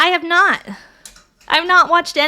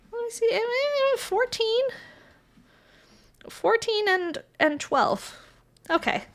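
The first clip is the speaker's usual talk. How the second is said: much softer, counting under breath; muttering aside; 7 semitones higher, 10 dB quieter than usual. Real, not acted. To herself while checking kit parts, soft mutter. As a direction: mumble the numbers to yourself.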